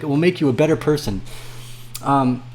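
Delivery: ordinary talk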